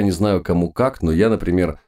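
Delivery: ordinary delivery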